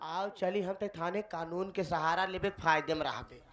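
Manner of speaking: soft, low coaxing; quiet, low-pitched advice; calm persuasion. Acted. Calms him, advising police.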